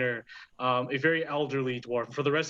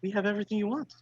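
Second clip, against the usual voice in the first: higher, put-on Irish hostess voice; Irish Mrs. Doubtfire; 7 semitones higher, much faster than usual. Dwarf bartender offering ales to a patron, put-on Irish accent. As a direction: play an obliging, badly faked Irish barkeep. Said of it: "Irish Miss Doubtfire".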